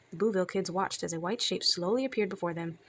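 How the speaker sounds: ordinary delivery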